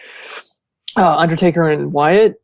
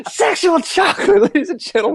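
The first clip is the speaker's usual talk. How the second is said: Eddie Murphy's preacher character; 13 semitones higher than usual. Retold or imitated. Quoting a movie character for laughs, high hammy announcer voice. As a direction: imitate a comic preacher presenting an act, pitched way up. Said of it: "Murphy's character in Coming to America as the preacher".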